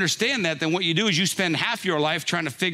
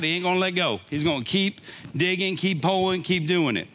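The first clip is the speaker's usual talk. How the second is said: ordinary talk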